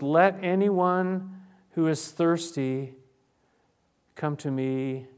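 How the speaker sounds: loud, slow proclamation. Acted. Preacher voicing Jesus at the festival, loud and slow. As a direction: call it out slowly to the festival crowd. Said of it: "he said in a loud voice".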